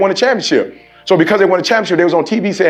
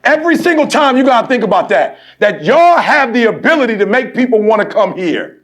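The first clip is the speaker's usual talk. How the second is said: preaching exhortation; 10 semitones higher, 4 dB louder than usual. Acted. Exhorting players to set example.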